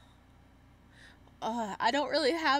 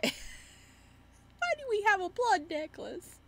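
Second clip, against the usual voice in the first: laughing disbelief; 9 semitones higher, slower than usual. Real, not acted. Laughing at strange item.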